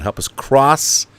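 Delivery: usual speaking voice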